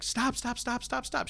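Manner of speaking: high, frantic repeated stop. Retold or imitated. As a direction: plead with the parrot to stop, pitched high. Shaking the parrot, yelling stop.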